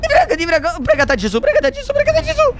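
desperate pleading. Real, not acted. Player begs friends to pray the mushroom avoids the lava, high and frantic. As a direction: beg in panic as it nears the lava.

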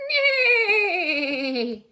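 a high, loud whinny; loud neigh. Shown as a demonstration. Teacher answers her own quiz with a loud, high neigh. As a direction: neigh out like a horse. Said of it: "how about the horse?".

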